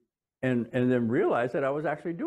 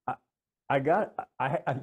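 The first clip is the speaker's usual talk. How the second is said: usual speaking voice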